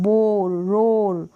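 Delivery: "बोर, रोर" slowly, with a long open aw; long aw sound. Shown as a demonstration. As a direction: stretch the vowel in "बोर, रोर". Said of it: "इसको अ pronounce कर रहे".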